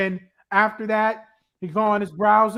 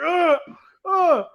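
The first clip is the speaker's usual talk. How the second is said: straining groan; 7 semitones higher than usual. Retold or imitated. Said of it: "he's like".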